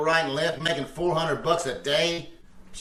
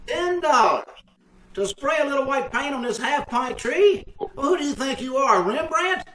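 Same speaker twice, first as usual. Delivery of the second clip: indignant haggling, voice raised high; indignant outrage; 6 semitones higher than usual. Acted. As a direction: balk at the price like he's been robbed. Outraged at the price.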